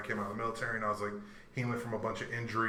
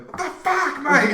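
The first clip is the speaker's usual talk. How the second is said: high, loud Australian outburst, close to laughing; Nick's heavy Australian accent; 13 dB louder, slower than usual. Retold or imitated. Aussie teammate after the ball bounces off him, Australian accent. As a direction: blurt it loudly in an Aussie accent. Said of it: "he's got this heavy Australian accent".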